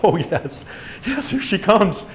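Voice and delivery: high, gleeful talk to oneself; giggly relief. A narrator's reading. Boy spotting his twin coming to rescue him, giddily relieved. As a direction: let relief rise into a near giggle. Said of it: "giggled to myself".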